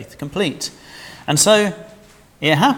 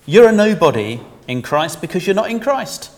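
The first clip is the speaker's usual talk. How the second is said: stern rebuke; 5 semitones higher, 6 dB louder than usual. Retold or imitated. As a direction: call him out sternly. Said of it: "He'd say".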